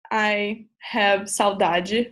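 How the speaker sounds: "I have saudade," drawn out slowly; slow demo phrase. Shown as a demonstration. Sharing a favourite word with classmates, slow and careful. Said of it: "you can say".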